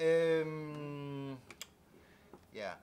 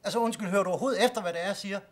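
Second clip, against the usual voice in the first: quick, louder and higher, fed up; fast indignant scold; 5 semitones higher, 7 dB louder, much faster than usual. Acted. Patient to doctor who ignores him, fast indignant scold. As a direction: rattle it off in indignation.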